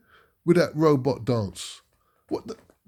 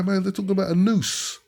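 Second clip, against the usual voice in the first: ordinary talk, nothing marked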